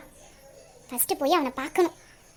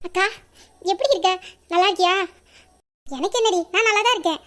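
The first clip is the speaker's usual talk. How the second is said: ordinary delivery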